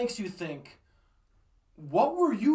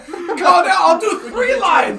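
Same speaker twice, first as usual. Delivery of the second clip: infomercial shout; 10 semitones higher, 12 dB louder, slower than usual. Retold or imitated. Infomercial parody, shouted laughing.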